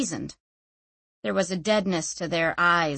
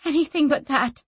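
panicked; 7 semitones higher than usual. Acted. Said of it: "she thought, panicked".

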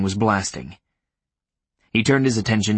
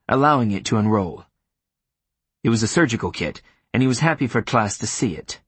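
usual speaking voice

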